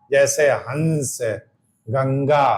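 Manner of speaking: slow हंस and गंगा, nasal pushed through the nose; teacher demonstrates the anusvara; nasal sound demo. Shown as a demonstration. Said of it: "नाक पे जोर पड़ रहा है".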